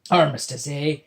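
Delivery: loud pirate bark; barked pirate line. Acted. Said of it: "Bloody John barked".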